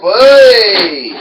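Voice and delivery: commentator shout. Acted. Commentator reacting to top rope.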